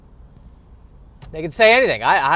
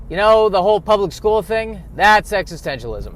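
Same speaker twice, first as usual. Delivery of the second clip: imitating a teacher, louder and higher; deadpan mock lecture, pushed up; 6 semitones higher, 7 dB louder than usual. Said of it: "all he should have said was".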